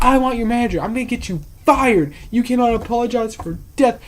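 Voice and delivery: high, loud mock threat; indignant mock tirade; retold yelling. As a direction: imitate a furious patron demanding the manager. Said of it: "she's yelling at me".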